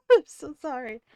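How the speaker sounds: laughing embarrassed apology. Real, not acted.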